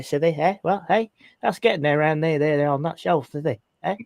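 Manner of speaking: broad, garbled northern English dialect; northern tribe talk. Shown as a demonstration. Englishman baffles American co-hosts with local talk, thick northern dialect. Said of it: "northern tribe talk".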